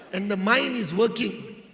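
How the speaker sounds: usual speaking voice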